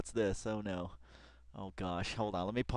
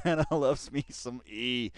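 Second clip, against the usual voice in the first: mock slang again; slower than usual. Fan repeating his [name] line, slow mock. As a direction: repeat it lazily.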